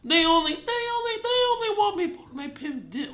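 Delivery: high, stuttering sampler replay; keyboard sampler imitation. Host mimics the song sample played on keys, high and stuttering. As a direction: repeat the opening like a triggered sample, pitched high. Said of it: "playing it on the keyboard".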